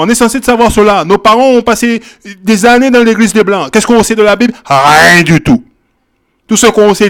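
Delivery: ordinary talk, nothing marked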